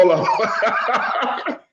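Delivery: high, laughing hold on; giggling through the words. Real, not acted. Laughing at camera mishap.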